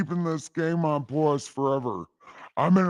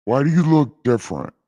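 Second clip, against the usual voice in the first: louder, fast booming question; God questioning Adam; 2 semitones lower, 5 dB louder, faster than usual. Retold or imitated. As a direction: demand it like a suspicious God. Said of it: "God voice".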